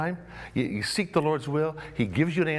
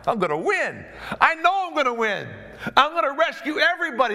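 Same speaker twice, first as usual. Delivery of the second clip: high, loud, laughing cry of triumph; David rallying after God's promise, excited and high; fired-up David; 10 semitones higher, 3 dB louder than usual. Acted. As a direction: shout it like a man sure of victory.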